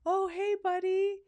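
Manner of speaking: high, sweet coaxing voice, slowing at the end; friendly guy to a warthog. Retold or imitated. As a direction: coax the warthog like a pet. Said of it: "he's like".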